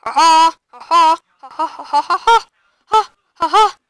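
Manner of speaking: loud gleeful cackle; gleeful laughter. Real, not acted. Kid laughing at burning spider.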